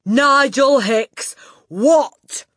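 very slow, high, loud bellow. A narrator's reading. Headmistress correcting a small boy, bellowed. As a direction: bellow it loud enough to knock him over. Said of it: "the Trunchbull bellowed".

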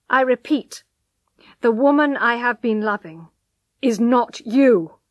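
loud, emphatic, slowing at the end; firm rejection. A narrator's reading. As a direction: reject her firmly and loudly. Husband rejects his pleading wife.

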